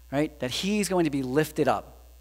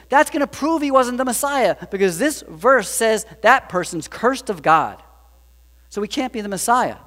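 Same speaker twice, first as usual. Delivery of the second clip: plotting; 6 semitones higher, 6 dB louder than usual. Retold or imitated. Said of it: "They're like".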